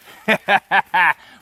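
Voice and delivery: big burst of real laughter; laughing hard at the punchline; loud laugh. Real, not acted. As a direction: crack up at your own joke. Laughs at his own punchline.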